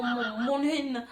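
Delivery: ordinary talk, nothing marked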